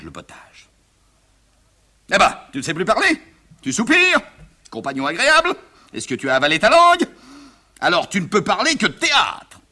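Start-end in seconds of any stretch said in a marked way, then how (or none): 2.1-9.6 high-pitched rising taunts; exasperated father prodding for an answer; sarcastic needling. Acted. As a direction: goad him with mock questions. Father needles silent son.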